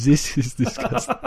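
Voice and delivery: put-on laughing, slow, high German accent; Branko German accent. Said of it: "Yeah, Branko the German".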